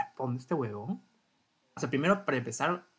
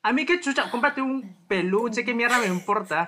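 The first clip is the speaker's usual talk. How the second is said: indignant rant; 7 semitones higher, 5 dB louder than usual. Real, not acted. Ranting about ex's excuse.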